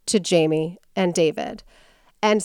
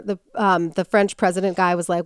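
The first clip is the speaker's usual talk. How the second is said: normal talking voice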